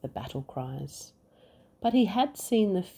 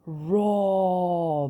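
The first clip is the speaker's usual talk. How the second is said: bellow; 3 semitones higher, 4 dB louder than usual. Acted. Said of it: "bellowed the dragon".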